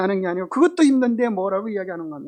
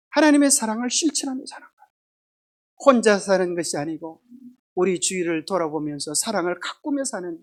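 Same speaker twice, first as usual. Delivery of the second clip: ordinary talk, nothing marked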